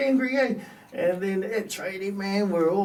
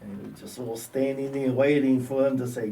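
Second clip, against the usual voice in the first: ordinary talk, nothing marked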